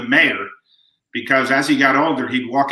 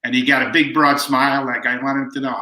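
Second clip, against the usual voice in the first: ordinary talk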